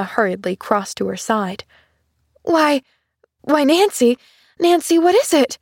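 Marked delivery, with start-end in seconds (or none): 2.4-5.6 high, worried calling of her name; rising alarm as she rushes over; anxious cry. A narrator's reading. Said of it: "she cried".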